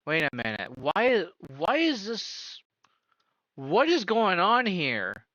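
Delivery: ordinary delivery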